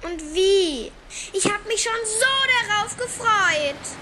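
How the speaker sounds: high, loud, furious complaint; angry little brother. Acted. Little brother yells in anger about his dog. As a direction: let the boy's anger burst out loud. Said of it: "Vor Wut wurde Max' Gesicht ganz rot".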